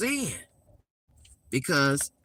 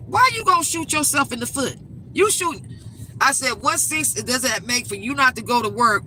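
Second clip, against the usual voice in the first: exasperated scolding; 6 semitones higher than usual. Retold or imitated. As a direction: demand he make it make sense. Mother scolding grown son.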